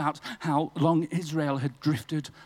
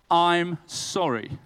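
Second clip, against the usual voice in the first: slow deadpan reveal; 4 dB louder, much slower than usual. Acted. Reading friend's note, deadpan.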